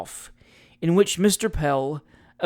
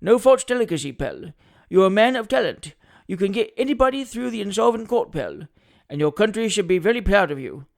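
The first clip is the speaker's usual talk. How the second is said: grand, higher quoted praise; attorney quoting Lord Chancellor; 3 semitones higher, 4 dB louder than usual. Acted. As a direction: declaim the great man's compliment proudly.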